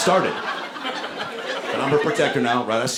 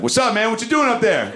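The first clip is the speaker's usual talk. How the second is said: mock aggressive, pitched high; tough guy challenge; 9 semitones higher than usual. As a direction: act tough with a stranger. Confronting naked man in tree.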